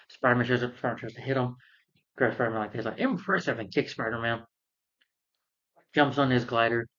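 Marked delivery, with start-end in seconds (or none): 3.0-3.6 high-pitched mock-admiring taunt; Green Goblin. Goblin grabbing Spider-Man before the kick, taunting. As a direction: sneer it as a villain, pitched up.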